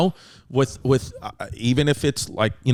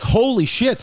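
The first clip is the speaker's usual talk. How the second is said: "Holy shit" tossed up high, like he's stunned all over again; retold amazement; 8 semitones higher, slower than usual. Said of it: "But I was like".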